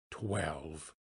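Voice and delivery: low, soft, stunned echo; hushed disbelief. A narrator's reading. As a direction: drop right down, almost to yourself. Scrooge repeats the hour in disbelief.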